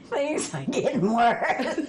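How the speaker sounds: giggling out the quote; can't keep from laughing; said through a laugh; laughing through line. Retold or imitated. Mother's deadpan line, retold laughing.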